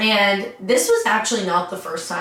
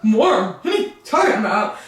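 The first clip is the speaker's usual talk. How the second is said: dopey husband; 4 semitones higher than usual. Retold or imitated. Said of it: "And then he's like".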